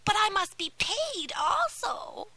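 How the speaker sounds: high, whispery menacing witch; a crooning sea witch, sly and cruel. Acted. Sea witch demands payment.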